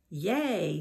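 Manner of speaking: cheering shout. A narrator's reading. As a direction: younger sister's hooray. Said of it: "Dee Lee shouted".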